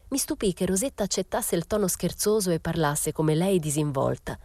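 ordinary delivery, nothing marked

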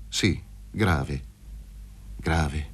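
low, soft and sombre; grave. Acted. Luca telling Paroni he has serious news, low and grave. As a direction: let each word drop darkly.

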